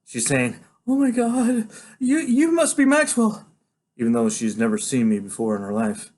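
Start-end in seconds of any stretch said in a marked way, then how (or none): 0.9-3.5 high, drawn-out astonished exclamation; breathless-with-shock woman's voice, pitched up; shocked nurse. A narrator's reading. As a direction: gasp it high, as a startled nurse. Nurse startled at meeting him.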